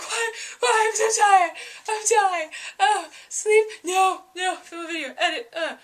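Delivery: high, loud, frantic mock wail; hammed-up exhausted panic. Vlogger acting out daily filming and editing, hammed-up frantic exhaustion. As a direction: wail in short bursts like an overworked wreck. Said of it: "that's basically what it was like".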